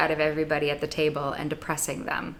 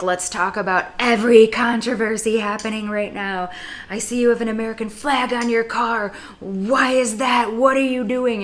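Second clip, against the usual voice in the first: higher, pushy mock neighbor voice, slowing on the question; combative neighbor; 4 semitones higher, 3 dB louder than usual. Mimicking the combative neighbor who questioned her flag. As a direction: imitate a nosy, combative neighbor grilling you. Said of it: "it would always just be combative".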